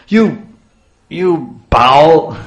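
loud, high-pitched outburst of rebuke; scolding husband. Acted. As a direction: berate the wife, hammed-up. Husband scolding his crying wife.

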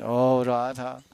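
high, drawn-out lament calling Radha; crying in separation. Acted. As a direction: cry out her name in longing. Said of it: "he cries in separation".

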